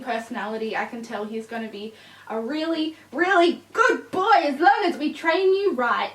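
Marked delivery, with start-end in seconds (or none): 2.3-6.1 higher, louder praise to the dog; puppy talk. Real, not acted. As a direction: gush at the puppy.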